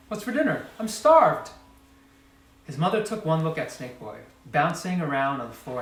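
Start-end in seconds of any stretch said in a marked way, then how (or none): 0.1-1.5 high, loud, eager demand; eager hungry child. Acted. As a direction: beg for food impatiently.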